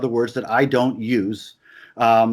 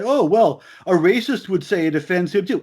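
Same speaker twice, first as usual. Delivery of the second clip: mock objector; 5 semitones higher than usual. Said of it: "if people say".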